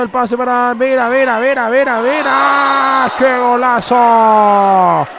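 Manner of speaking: rising excitement to a held goal shout; breakneck goal commentary. Real, not acted. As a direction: build from rapid name calls to the scream. Attack building to a goal.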